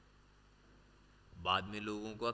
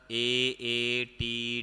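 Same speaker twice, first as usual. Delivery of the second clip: slow letter by letter; 3 dB louder, much slower than usual. Shown as a demonstration. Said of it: "मेरे को क्या मिलेगा?".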